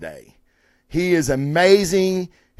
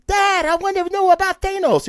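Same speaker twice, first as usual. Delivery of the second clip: put-on much higher, pleading child; little kid voice. Kid asking his dad about Thanos, in a high child voice. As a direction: squeak it out like an eager little boy. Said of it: "comes up to you and goes".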